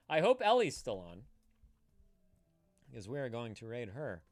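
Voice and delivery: ordinary talk, nothing marked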